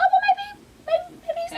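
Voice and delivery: very high, squeaked reconsidering; high-pitched comic backtracking; second thoughts. Retold or imitated. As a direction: act out a sudden change of mind, way up in pitch. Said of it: "I was like".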